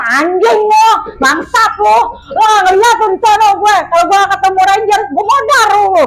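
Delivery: mock cursing, barely held back; swallowed curse-out. Held-back rant at friends who left her behind, imitated angry. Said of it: "mulut gue udah pengen ngomong kasar".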